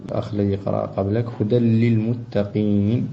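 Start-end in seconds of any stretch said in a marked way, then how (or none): 1.4-3.1 هدى للمتقين with the nun fully merged into the lam; full assimilation. Shown as a demonstration. Teacher correcting the student's assimilation. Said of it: "والإدغام تام".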